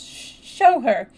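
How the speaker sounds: high, broken stammer; halting, tearful outburst; gasping between sobs. A narrator's reading. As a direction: gasp it out through sobs. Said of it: "gasped out between two sobs".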